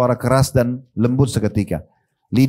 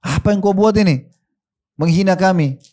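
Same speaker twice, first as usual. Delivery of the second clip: higher-pitched, mildly indignant retort; retold offended anger; 7 semitones higher than usual. Friends confront the man who insulted them, retold anger. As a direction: act out offended friends demanding an explanation. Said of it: "Teman-temannya semua pada marah, tersinggung".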